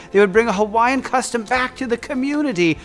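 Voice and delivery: ordinary delivery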